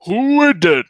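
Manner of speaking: booming high-pitched doorman shout; dopey cartoon bellow. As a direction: bellow it through the door like a dim brute. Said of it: "a deep bellowing voice".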